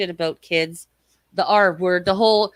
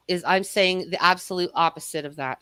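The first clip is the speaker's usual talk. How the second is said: normal talking voice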